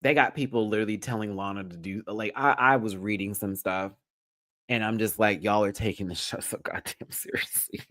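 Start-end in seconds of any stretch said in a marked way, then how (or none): 5.4-7.8 exasperated whisper with a laugh. Retold or imitated. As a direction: breathe it out laughing.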